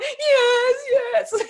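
high, loud tearful yes through laughter; crying like a baby. Retold or imitated. Retelling sobbing to the airport clerk, laughing-crying. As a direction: sob it out half laughing at yourself. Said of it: "crying like a baby".

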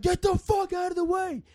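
road rage yell. Retold or imitated. Driver with road rage yelling at the car ahead, furious shout. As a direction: scream at the car blocking you. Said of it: "And so I had road rage".

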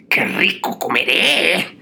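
slow, gloating villain line; mustache twirling villain. Acted. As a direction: savor each word like a hungry villain. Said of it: "dijo el pequeño tiburón".

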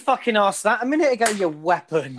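high, laughing mock telling-off; laughing scold. Real, not acted. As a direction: tease him through laughter.

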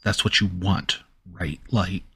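ordinary talk

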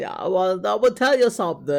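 drawn-out imitation of royal British English; Prince Charles. Co-host mimicking the prince for the reviewer, British accent. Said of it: "como el Prince Charles".

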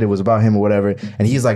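usual speaking voice

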